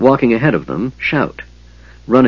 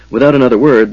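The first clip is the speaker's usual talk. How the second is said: usual speaking voice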